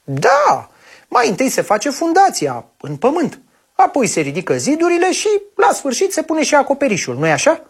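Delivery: put-on high, quick eager child voice; a happy kid showing what he knows; cheerful little boy. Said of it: "Întreabă prichindelul vesel". (copil vesel, cu voce subțire și grăbită)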